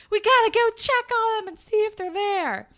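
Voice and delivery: hammed-up urgent rush to go; mock urgent voice. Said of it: "they're like".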